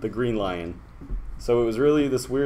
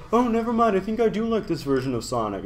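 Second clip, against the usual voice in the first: mock fickle fan; 10 semitones higher than usual. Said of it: "everyone's like".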